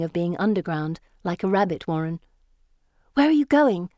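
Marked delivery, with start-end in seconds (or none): 3.1-3.9 high, loud, panicky question; frantic. A narrator's reading. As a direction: blurt it out frantically. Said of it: "I ask frantically".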